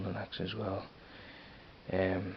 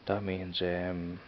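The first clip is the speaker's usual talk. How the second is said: normal talking voice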